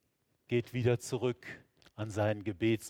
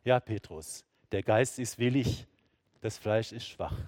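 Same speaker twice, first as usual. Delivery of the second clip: ordinary delivery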